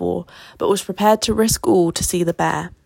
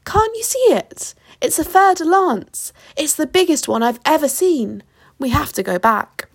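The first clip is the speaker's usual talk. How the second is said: high, insistent alarm; high-pitched panicky exclaiming; alarmed shouting; 7 semitones higher than usual. A narrator's reading. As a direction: insist on the danger in a high, urgent voice. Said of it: "he shouted".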